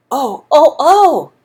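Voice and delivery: light mock alarm from a mother; mother's surprise. A narrator's reading. Mother peering into Andrew's mouth, mild mock surprise. Mother voiced examining tooth.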